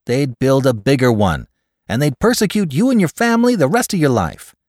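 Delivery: high, emphatic reasoning, lightly acted; logical explanation. A narrator's reading. Caller explains to his friend why sabotage fails, mildly insistent and logical. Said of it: "explained Cross logically".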